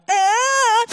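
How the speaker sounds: high, loud choked "ah" of the moved dad; imitating a touched father's gasp; mock emotional gasp. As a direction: let a sudden cry of emotion escape. Dad reacts behind her.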